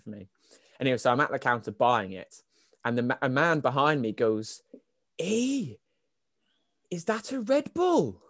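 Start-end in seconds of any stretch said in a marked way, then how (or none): 5.2-8.2 high, drawn-out Welsh impersonation; Welsh accent. Retold or imitated. Man behind at petrol counter, put-on Welsh accent. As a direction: put on a slow, lilting Welsh voice. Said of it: "he was Welsh, so it's a very good accent".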